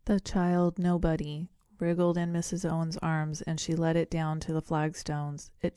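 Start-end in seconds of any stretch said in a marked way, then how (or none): none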